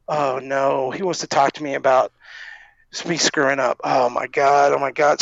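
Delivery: low, subdued voice of dread; sinking dread. Retold or imitated. Staffer summoned by the Undertaker after screwing up, sinking dread.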